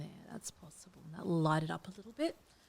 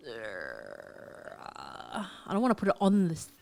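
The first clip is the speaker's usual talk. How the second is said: normal talking voice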